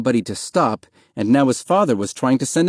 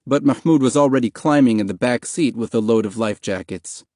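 usual speaking voice

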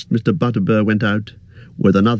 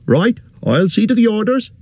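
higher, fussy innkeeper voice; chatty innkeeper chattering on, pitched up. Acted. Innkeeper warning hobbit guest, higher fussy voice.

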